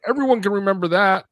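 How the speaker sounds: high, loud, fast smug cult leader; mock cultist voice. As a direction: proclaim it like a cheery cult leader. Mock cultist boasting to members.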